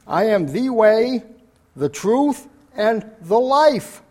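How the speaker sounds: high, loud, slow proclamation; rising preacher's climax. Acted. Preacher proclaiming Christ's words to the congregation, loud and slow. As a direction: build it up and ring it out slowly.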